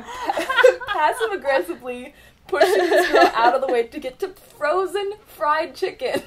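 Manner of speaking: high, loud words breaking into laughter; helpless giggly exclamation; laughing embarrassment. Real, not acted. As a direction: try to get it out while cracking up. Laughing at own embarrassing story.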